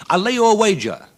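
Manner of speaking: high, loud ringing challenge; bold wager. Acted. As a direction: throw the bet down proudly. Champion boldly challenges the doctors.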